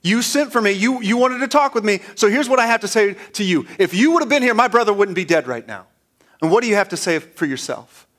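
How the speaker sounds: voicing a grieving sister who confronts Jesus; Mary's anger retold. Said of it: "so I'm just gonna let you have it".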